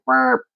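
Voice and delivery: high squeaky "burp" of the brakes; mock brake noise. Imitating the Waymo slamming the brakes with a high burp. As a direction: chirp it like a sudden stop.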